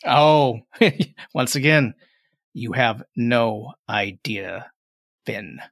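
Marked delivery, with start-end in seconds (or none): 2.5-5.6 very slow, low menacing line; drawn-out menace. Acted. Tiefling repeats his threat to Finn, slowly menacing. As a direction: stretch each word out, dropping away on the name.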